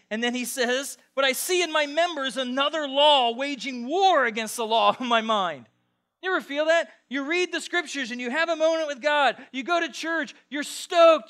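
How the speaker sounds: high, loud, fired-up preacher; full-force preaching. Real, not acted.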